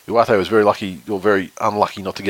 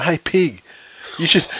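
retold yell; 8 semitones higher, slower than usual. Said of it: "he yells out".

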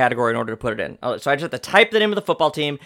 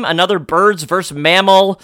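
ordinary delivery

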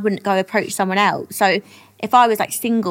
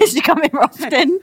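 higher, laughing cheesy line; cheesy pickup line; 5 semitones higher, 3 dB louder than usual. Pretend. Friend acting out a corny opener, laughing. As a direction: play it corny. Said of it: "What would you say".

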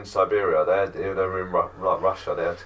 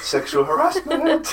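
high mock quip; slower than usual. Real, not acted. Joking quip.

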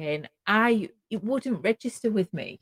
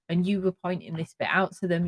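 ordinary talk

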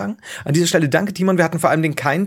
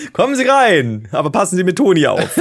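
host voice; 4 semitones higher, 5 dB louder than usual. Pretend. Spielt Türsteher im Pokerkeller.

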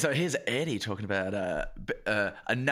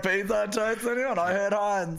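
goofy worker; 7 semitones higher, slower than usual. Pretend. Playing along, beans bit.

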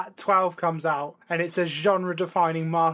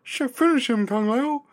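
Kermit voice; 6 semitones higher than usual. Retold or imitated. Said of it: "shout-outs as Kermit the Frog".

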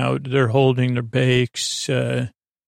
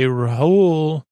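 lightly imitated winner reveal, raised pitch; winner announcement; 3 semitones higher, 4 dB louder than usual. Recapper echoing the hosts naming the winner, mock announcement. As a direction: call the name like a host, mildly.

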